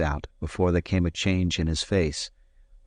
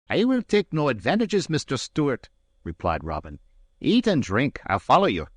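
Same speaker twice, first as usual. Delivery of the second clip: higher, grandly polite Highland gentleman; Robin's stiff politeness, pitched higher; Robin, courteous; 7 semitones higher than usual. A narrator's reading. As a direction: offer the advantage with lofty courtesy. Robin voiced, courteous challenge.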